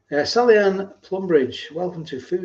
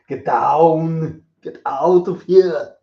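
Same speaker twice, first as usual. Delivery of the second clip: slow, loud Austrian action-hero bark; Arnold Schwarzenegger impression; 8 dB louder, much slower than usual. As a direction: shout it like Arnold in a gunfight. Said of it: "an Arnold impression".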